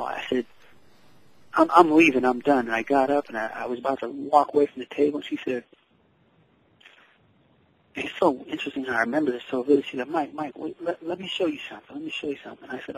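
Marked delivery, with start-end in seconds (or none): 1.5-2.7 sharp, higher, louder mock tantrum; angry outburst. Boy quits the painting table in a higher, louder huff. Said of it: "I started getting angry". 10.1-12.7 soft, quiet coaxing; gentle mom. Retold or imitated. Mom softly calls her son back to the table.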